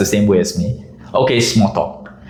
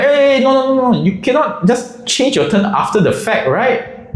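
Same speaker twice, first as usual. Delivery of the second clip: everyone objecting at once; group protest; 12 semitones higher than usual. Retold or imitated. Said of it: "everybody gets like".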